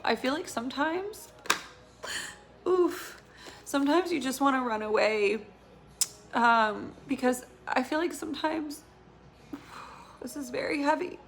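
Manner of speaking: fighting back tears through the words; choked up. Real, not acted. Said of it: "oh, I'm getting emotional".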